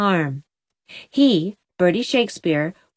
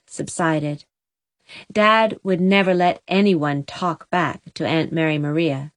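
ordinary delivery, nothing marked

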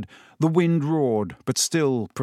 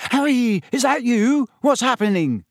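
anxious; 10 semitones higher, 4 dB louder than usual. Acted. Neville anxious in dark.

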